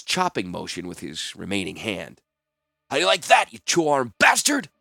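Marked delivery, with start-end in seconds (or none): 2.9-4.7 loud, high-pitched jeer; savage, drawing out the insult; vicious taunt. A narrator's reading. Captive acting out revenge on the healer, vicious taunt. Mimes chopping off healer's arm.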